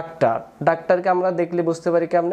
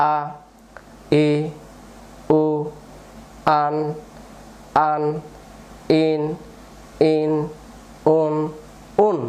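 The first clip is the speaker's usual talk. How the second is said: one syllable at a time, long pauses between; broken-up syllable drill; slower than usual. Shown as a demonstration. Teacher breaking the line up for new learners, syllable by syllable. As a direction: sound out each syllable separately, leaving gaps. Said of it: "ভেঙে ভেঙে পড়বো".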